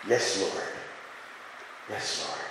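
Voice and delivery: fading from a low murmur to a breathless whisper; a hushed, real "Yes, Lord" in prayer; prayerful whisper. Real, not acted. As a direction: whisper it to God. Quiet prayerful affirmation.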